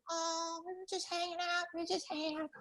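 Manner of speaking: put-on sheepish girlfriend.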